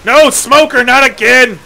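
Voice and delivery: high, loud cry of dismay; real game panic yell; frustrated yell. Real, not acted. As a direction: shout at the smoker attacking again. Dying in game, yelling.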